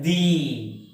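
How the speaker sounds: "the" said as thee, with long ee; long ee vowel in "the"; strong form of the. Shown as a demonstration. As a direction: draw out "the" to rhyme with see. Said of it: "have to pronounce this word as".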